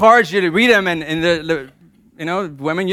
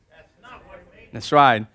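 ordinary talk, nothing marked

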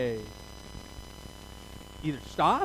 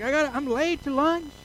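careless excuse; 9 semitones higher, 4 dB louder than usual. Pretend. Brushing off injured friend.

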